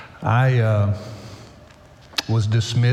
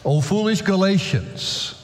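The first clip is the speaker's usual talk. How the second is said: higher-pitched, declaimed Bible reading; scripture reading; 6 semitones higher than usual. Acted. Preacher opening Galatians chapter three to the church, declaimed scripture reading. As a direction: proclaim the verse to the congregation. Reads scripture aloud to congregation.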